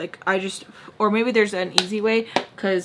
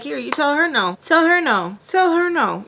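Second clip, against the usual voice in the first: higher, insistent repeated order; insistent urging; 6 semitones higher than usual. Acted. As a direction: build in pitch with each repeat. Acting out urging someone on phone.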